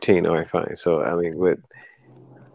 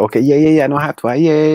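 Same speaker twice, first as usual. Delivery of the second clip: mock dismissive yeahs; 8 semitones higher, 6 dB louder, faster than usual. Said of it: "you're like".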